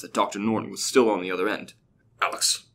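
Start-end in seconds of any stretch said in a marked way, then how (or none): 2.2-2.6 urgent calling, second call louder; frantic. A narrator's reading. Doctor calling Alex on the phone, frantic and urgent. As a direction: Norton calls down the phone in a panic, a touch frantic. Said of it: "he said frantically".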